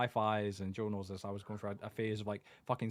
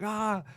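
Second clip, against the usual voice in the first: high loud furious scream; enraged yell; 11 semitones higher, 5 dB louder than usual. Retold or imitated. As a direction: let out a raging shout. Dad raging down the phone.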